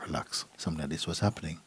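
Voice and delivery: usual speaking voice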